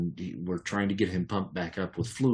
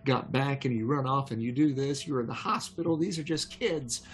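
scolding yell; 7 semitones higher than usual. Retold or imitated. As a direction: tell him off like a worried partner. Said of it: "she's yelling at him".

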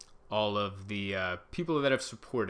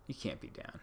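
breathy whisper to the screen; whispered aside; 12 dB quieter than usual. Real, not acted. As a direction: whisper it under your breath, pleading. Pleading aside to Twitter.

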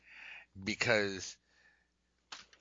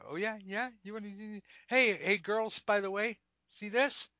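higher, hammed-up sassy brag; sassy showoff; 11 semitones higher, 4 dB louder than usual. Stripper bragging to the girls in a high sassy voice. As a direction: show off to the girls, teasing.

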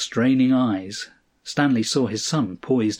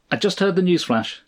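brisk, higher-pitched phone voice; clipped, exact; 7 semitones higher, faster than usual. A narrator's reading. Old colleague phoning Stanley about the news, clipped and exact. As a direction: precise and quick, a little higher. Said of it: "had the same clipped, exact voice".

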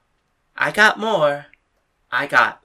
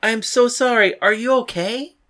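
higher, anxious apology; concerned, pitched up; worried apology; 4 semitones higher than usual. Acted. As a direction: rush to check on the friend you hurt. Apologizing to fallen friend.

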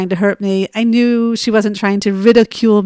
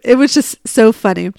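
ordinary talk, nothing marked

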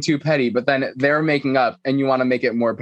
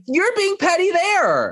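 very high, slow sing-out; mock scolding; slower than usual. Retelling a castmate butting into a hug, high drawn-out accusation. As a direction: call it out high and stretched. Acting out castmate calling out pettiness.

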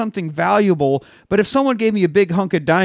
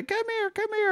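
put-on coaxing child; 9 dB quieter than usual. Boy coaxing a bird.

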